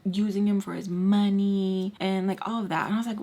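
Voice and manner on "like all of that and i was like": ordinary talk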